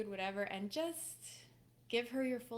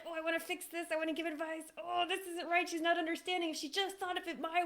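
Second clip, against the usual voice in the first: high, loud, exasperated mock inner monologue; hammed-up agitated fixer man; frustrated inner voice; 8 semitones higher, 3 dB louder than usual. Coach imitating a husband's frustrated thoughts, high and exasperated. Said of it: "Even when you're like".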